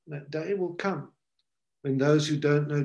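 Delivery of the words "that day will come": That day will come repeated much softer; soft echo. Real, not acted. Preacher warning the congregation of judgment, hushed. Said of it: "but that day will come".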